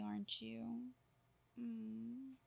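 ordinary talk, nothing marked

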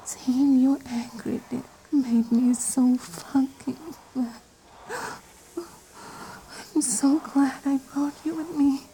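high breathy pretend moans; high moaning. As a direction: gasp it out, high and eager. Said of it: "It would be more like".

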